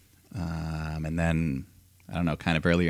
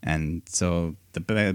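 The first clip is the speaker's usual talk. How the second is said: usual speaking voice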